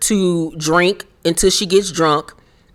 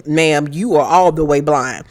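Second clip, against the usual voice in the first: ordinary talk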